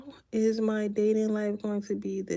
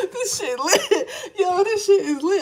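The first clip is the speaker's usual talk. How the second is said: laughing through the words; real giggling delight; breaking into laughter mid-line; 8 semitones higher, 5 dB louder than usual. Real, not acted. Cracking up at the spirit.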